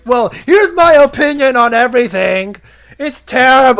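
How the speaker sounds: high, loud whiny shout; shrill mock rant; mocking impression. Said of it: "Kinura comes by and is like".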